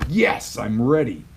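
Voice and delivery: high, loud burst of readiness; pumped up. Shown as a demonstration. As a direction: fire yourself up for the day. Demonstrating an energized morning anchor.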